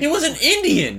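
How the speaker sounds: high, loud incredulous outburst; loud squawk of protest; indignant disbelief. Real, not acted. Disbelieving objection in an argument.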